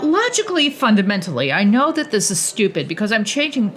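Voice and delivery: ordinary delivery, nothing marked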